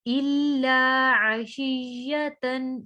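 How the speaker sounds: slow, drawn-out tajweed recitation; Quran recitation. Shown as a demonstration. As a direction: recite it with ghunna on the noon. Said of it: "sorry ये तन था मैं देखा नहीं". (धीमी तजवीद वाली तिलावत)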